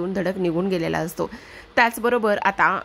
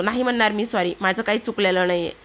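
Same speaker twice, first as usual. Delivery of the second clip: usual speaking voice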